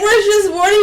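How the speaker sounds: high and laughing through the mock question; a hammed-up laughing question. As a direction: giggle the question out. Imitating David while laughing.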